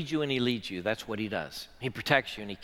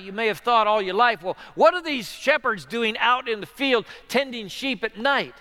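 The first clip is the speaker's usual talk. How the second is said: loud, high, driving sermon delivery; full-force preaching; 7 semitones higher, 8 dB louder than usual. Acted.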